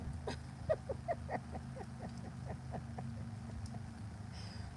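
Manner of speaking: high breathy burst of laughter; hearty laugh. Real, not acted. Grandma laughing at her own threat.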